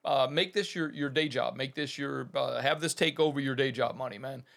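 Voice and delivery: ordinary delivery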